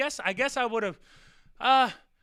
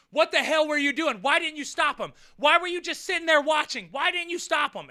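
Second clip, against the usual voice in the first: higher, louder scolding; scolding mom; 6 semitones higher, 5 dB louder than usual. Retold or imitated. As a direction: tear into him like an angry mother. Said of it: "And Ronnie".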